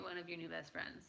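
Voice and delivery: ordinary talk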